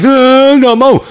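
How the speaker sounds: loud, high mock wail; crying child. Child refusing papa, loud mock crying. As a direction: burst into a bratty fake cry. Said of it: "ketika dia nangis".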